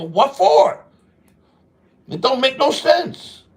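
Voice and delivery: high, punchy challenge, retelling a comeback; incredulous retort. Retold or imitated. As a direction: throw it back as a high-pitched, baffled question. Retold retort to being hurt.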